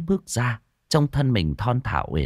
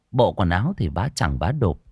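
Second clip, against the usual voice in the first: ordinary delivery, nothing marked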